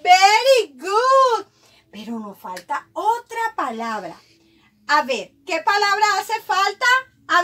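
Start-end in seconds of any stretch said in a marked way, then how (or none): 0.0-1.5 loud, slow, stretched cheer; excited praise. Real, not acted. Teacher praising child's reading. (elogio lento, fuerte y entusiasta)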